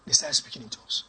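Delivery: soft, breathy hush. Real, not acted. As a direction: drop to a hush to stress it. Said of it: "dropped to hush".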